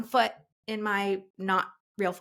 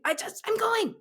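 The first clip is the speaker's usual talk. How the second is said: frantic; 13 semitones higher than usual. Retold or imitated. Retold frantic exit.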